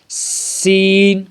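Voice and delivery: سین drawn out slowly with a whistling s; whistled s. Shown as a demonstration. Teacher shows students the whistling s in سین, slowly. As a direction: let the s of سین hiss like a whistle. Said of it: "سیٹی کی آواز نکلتی ہے".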